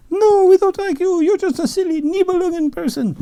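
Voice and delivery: very high, loud mocking maiden voices; shrieking Rhine maidens. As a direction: squeal the brush-off at him. Maidens rejecting Alberic.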